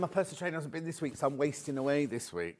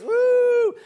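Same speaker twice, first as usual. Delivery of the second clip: high, loud "woo!"; celebratory whoop; 13 dB louder than usual. Real, not acted. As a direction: whoop at full voice to welcome someone back. Cheering presenter back from holiday.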